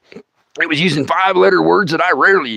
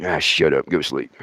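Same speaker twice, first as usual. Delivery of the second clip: low, soft irritated dismissal; grumpy brush-off; 13 semitones lower, 6 dB quieter than usual. Retold or imitated. He tells his thumb to hush, low sleepy grumble. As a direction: wave it off, half asleep.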